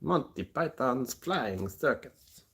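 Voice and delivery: slow, high British announcement; mock British accent. Streamer imitating Monty Python, slow mock British accent. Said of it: "sound like someone from Monty Python".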